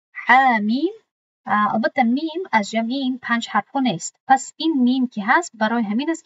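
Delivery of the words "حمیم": حمیم chanted in Quranic recitation, a bit louder; tajweed recitation of حمیم; recited. Shown as a demonstration. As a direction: recite حمیم with the long vowel held.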